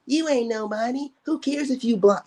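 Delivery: put-on dismissive comeback; mimicked dismissive commenter.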